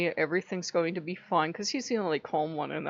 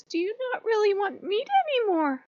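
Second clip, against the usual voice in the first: much higher, timid small question; timid Loki; 13 semitones higher than usual. Retold or imitated. Loki asking owner timidly in a high small voice. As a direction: ask meekly, afraid of the answer. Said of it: "Loki very timidly goes".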